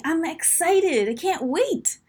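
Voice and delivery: high, eager and drawn out; can hardly wait, voice lifted high; happy, excited. Shown as a demonstration.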